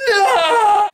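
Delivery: high, loud wailing no; anguished cry. Acted. Victim wails in despair at the reveal.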